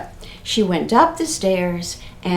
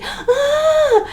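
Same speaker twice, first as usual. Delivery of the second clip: shrieking scream, much higher. Acted. Friend sees the ghost and shrieks, high. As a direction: let out a startled shriek. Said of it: "babysitter's friend screamed".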